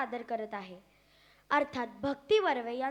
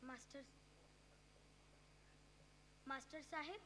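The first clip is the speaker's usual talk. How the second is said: very soft, tentative call to the master; small hushed voice; 23 dB quieter than usual. Acted. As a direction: call out under your breath, testing. Timid call opening the monologue.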